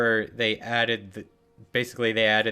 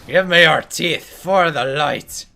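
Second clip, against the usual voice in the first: loud, slow, higher holy demand; mock zealous paladin; 6 semitones higher, 10 dB louder than usual. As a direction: declare it like a crusading zealot.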